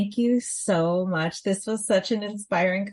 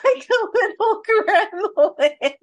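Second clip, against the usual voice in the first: laughing; 11 semitones higher, 5 dB louder than usual. Real, not acted. Laughing at spooky voice.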